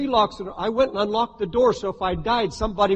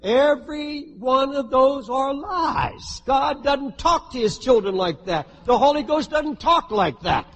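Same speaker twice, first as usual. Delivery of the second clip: raised preaching voice, higher and emphatic; forceful rebuttal; 6 semitones higher, 3 dB louder than usual. Real, not acted. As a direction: cry out against the lies with full conviction. Preacher loudly rebuts the lies.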